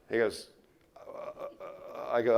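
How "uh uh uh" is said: breathless, voiceless uh-uh stammer; scared stammer. Retold or imitated. Smoker frozen with fear at the speaker's demand, voiceless stammer. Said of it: "You should have saw the fear in his eye".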